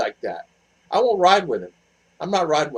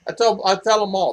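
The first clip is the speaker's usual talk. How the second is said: normal talking voice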